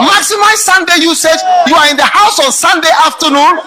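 loud, high, mocking preacher shout; preacher blasting pastors home by noon; full-force preaching. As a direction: hammer it from the pulpit.

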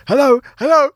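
mimicked high call to rouse someone. Man trying to rouse an unresponsive person, calling out.

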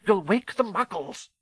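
high, urgent scolding; hissed warning. Acted. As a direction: warn sharply, pitched high. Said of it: "hissed Professor McGonagall".

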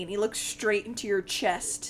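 ordinary talk, nothing marked

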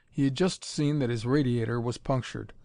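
usual speaking voice

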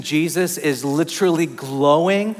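ordinary talk, nothing marked

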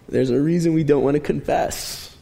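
usual speaking voice

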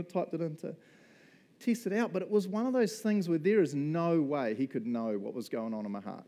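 normal talking voice

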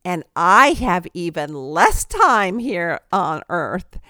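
imitating a friend's slow, emphatic delivery; slow, deliberate pauses. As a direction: take your time, weighing each phrase as you quote her. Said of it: "pauses a little bit before she speaks".

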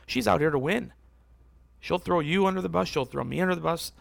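usual speaking voice

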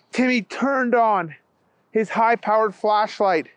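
ordinary delivery

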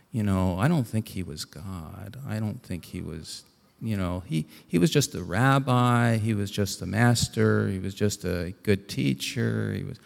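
soft skeptic's view. Retold or imitated.

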